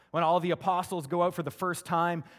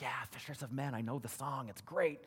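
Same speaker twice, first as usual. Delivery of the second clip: softer, bored been-there voice; bored churchgoer; 2 semitones lower, 6 dB quieter than usual. Retold or imitated. Pastor imitating a jaded churchgoer, softer and bored. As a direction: shrug it off like an old hymn. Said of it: "you're like".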